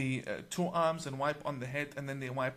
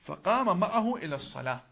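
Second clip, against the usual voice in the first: louder, quick Arabic recital; Arabic recitation; 5 dB louder, much faster than usual. Shown as a demonstration. Speaker quoting the hadith before translating it, loud quick Arabic recitation. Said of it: "I will recite in Arabic".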